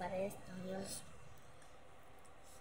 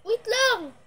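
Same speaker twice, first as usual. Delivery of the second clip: loud; 10 semitones higher, 10 dB louder than usual. Real, not acted. Stalling before picking.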